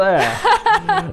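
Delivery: high, falling laugh; burst of high laughter. Real, not acted. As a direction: laugh out at the memory. Laughing at kids' shout.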